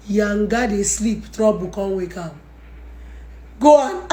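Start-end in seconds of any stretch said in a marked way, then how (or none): none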